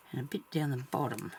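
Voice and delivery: usual speaking voice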